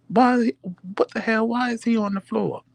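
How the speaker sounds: high, shocked questions; alarmed kids. Retold or imitated. Kids shocked to find him on the floor, high and alarmed. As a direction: ask in high alarm why he's on the floor. Kids panicking, asking what happened.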